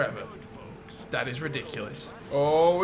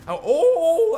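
high, loud laughing oh; excited laugh; 12 semitones higher, 9 dB louder than usual. Real, not acted. Spotting the perfect test car, delighted laugh.

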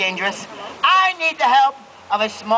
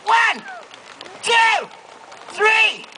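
calling out the count; 9 semitones higher, much slower than usual. Real, not acted. Counting aloud to lead crowd.

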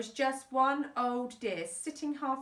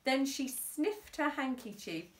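plain reading voice

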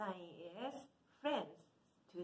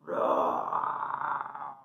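loud low growling roar; dinosaur roar; 7 semitones lower, 11 dB louder than usual. Acted. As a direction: roar big for the kids. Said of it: "what does he say".

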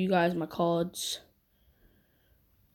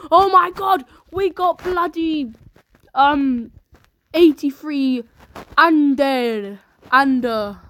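higher, loud surprised cry; 4 semitones higher, 5 dB louder, slower than usual. Real, not acted. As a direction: blurt it out in shock. Surprised at a pull.

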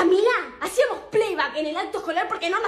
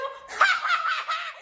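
mustache twirling villain; 13 semitones higher than usual. Acted. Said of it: "plans to publish the photo".

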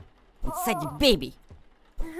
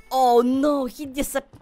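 high, loud cry of alarm; alarmed dismay; 6 semitones higher, 6 dB louder than usual. Pretend. Player sees the baby cat disappear, alarmed dismay. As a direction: cry out in sudden mock alarm.

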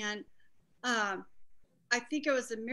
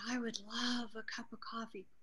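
barely voiced, weak whisper; whispered reply; 8 dB quieter than usual. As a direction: breathe it out like someone too weak to speak. Weak mother answers after near death.

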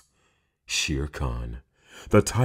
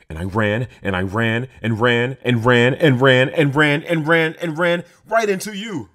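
rising higher and louder with each ran; building panic; 7 semitones higher, 6 dB louder than usual. Acted. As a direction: speed up the panic until it breaks. Frightened child retells chase.

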